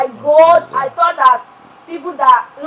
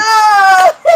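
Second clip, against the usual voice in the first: high squealing cry; 6 semitones higher, 6 dB louder than usual. Real, not acted. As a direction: squeal with glee. Squeal after compliment.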